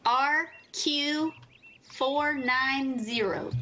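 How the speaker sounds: slow, spaced-out call sign. Real, not acted. Giving a contact number to someone hard of hearing, slowly. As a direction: spell it out slowly, one piece at a time. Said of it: "say it a little slower".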